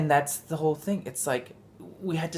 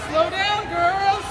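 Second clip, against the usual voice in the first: calling out; 6 dB louder, much slower than usual. Real, not acted. Mom calling across the room to her girls, high and loud. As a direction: call after them to slow down. Parent calling to running kids.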